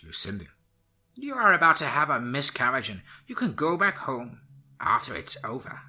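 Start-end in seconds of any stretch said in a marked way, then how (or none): 1.1-5.8 put-on old witch voice. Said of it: "said the old witch".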